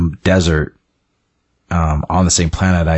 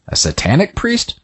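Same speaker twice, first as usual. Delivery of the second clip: higher-pitched, disbelieving query; incredulous question; 12 semitones higher than usual. Acted. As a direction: ask it as if taken aback, pitch up. Acted reply to priest theory.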